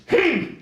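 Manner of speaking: short, very high disapproving hum; high-pitched grunt. Retold or imitated. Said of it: "The grunt was a high-pitched".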